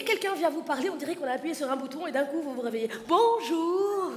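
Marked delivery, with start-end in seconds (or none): 3.1-4.1 sudden perky hello, as if switched on; sudden bright greeting. Retold or imitated. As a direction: pop awake with a cheery bonjour. Swiss person suddenly waking. (bonjour soudain et enjoué)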